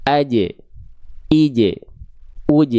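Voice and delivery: "aj, ij, uj" each stopped on a final j; jim closing syllable. Shown as a demonstration. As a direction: separate drill of "aj, ij, uj", vowel first. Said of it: "Seperti itu huruf jim dilafalkan".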